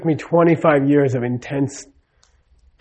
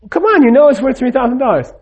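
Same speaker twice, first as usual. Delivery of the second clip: higher, pleading objection; mock protest; 8 semitones higher than usual. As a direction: push back at the boss, half-acted. Employee protests to the boss.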